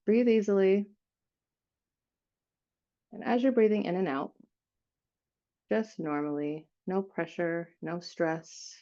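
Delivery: unhurried meditation guide with long pauses; guided meditation. Acted. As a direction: ease them into stillness, leaving space between phrases.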